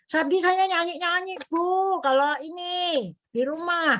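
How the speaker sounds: a light high wife's voice, unbothered; imitating a cheerful wife. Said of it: "katanya gitu istrinya". (menirukan istri pasien yang santai dengan suara lebih tinggi)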